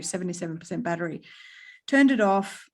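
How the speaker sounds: ordinary talk, nothing marked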